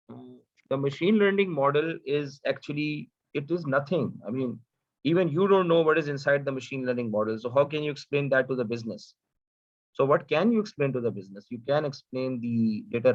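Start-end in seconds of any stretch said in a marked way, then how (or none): none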